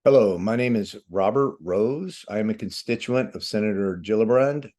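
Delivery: ordinary talk, nothing marked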